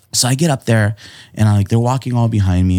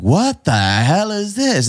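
shocked; 10 semitones higher, much slower than usual. Retold or imitated. Manager's first festival, imitated.

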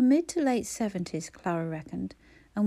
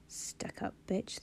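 slow, much softer breathless mutter under the breath; muttered insult; 11 dB quieter, slower than usual. A narrator's reading. Checkout girl insults Clara under her breath, slow and much softer. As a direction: hiss it under your breath so she can't hear. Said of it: "the girl muttered".

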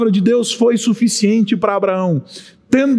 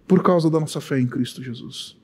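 ordinary talk, nothing marked

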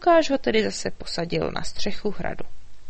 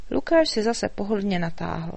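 ordinary delivery

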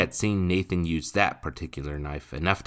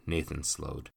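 plain reading voice

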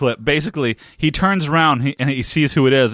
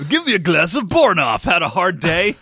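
lightly put-on, higher ad voice; mock beer advert; 5 semitones higher than usual. Co-host spoofing an ad for a made-up beer, mock advert voice. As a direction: pitch it like a spoof commercial.

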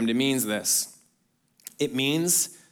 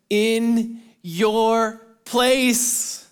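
slow emphasis; 6 semitones higher, 3 dB louder, much slower than usual. Acted. Preacher slows on key line.

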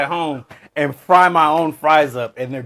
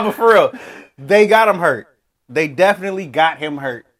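ordinary delivery

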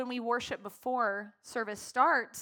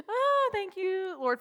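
tearful thanks; 8 semitones higher, 4 dB louder, slower than usual. Real, not acted.

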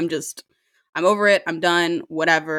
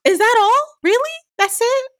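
high, loud incredulous questions; shocked waiter; 4 dB louder than usual. Retold or imitated. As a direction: play the stunned waiter. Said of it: "the waiter went".

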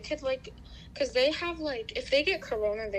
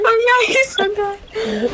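laughing; 11 semitones higher, 15 dB louder, much slower than usual. Real, not acted. Shocked laughing agreement.

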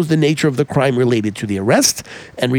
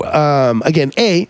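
ordinary talk, nothing marked

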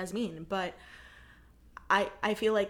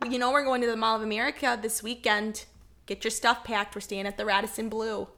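put-on Minnesotan accent; 4 dB louder than usual. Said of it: "I can do a Minnesotan accent".

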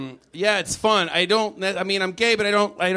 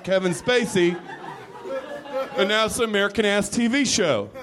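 mock patriotic American; 6 semitones higher than usual. Bragging about Americanizing the show, lightly mock patriotic. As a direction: puff it up like a proud patriot.

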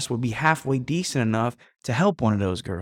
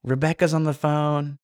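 mom voice; 2 semitones higher than usual. Retold or imitated. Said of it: "you hear the mom say".

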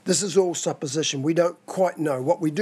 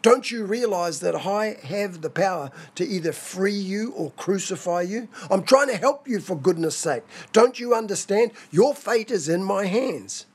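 raised-pitch exasperated appeal; frustrated; 3 semitones higher than usual. Acted. Pilate to a silent Jesus who refuses to speak, frustrated. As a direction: plead in mounting frustration, pushing the key words. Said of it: "moved with frustration".

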